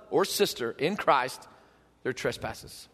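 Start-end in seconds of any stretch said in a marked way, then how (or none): 2.0-2.9 very soft and low, nearly whispered; solemn hush. Real, not acted. Preacher absorbing how much we are forgiven, solemn hush. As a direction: let it sink to an awed murmur.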